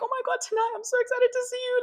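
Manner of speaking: put-on high excited boyfriend voice; excited gushing. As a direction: mimic his giddy excitement. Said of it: "he'd literally be like".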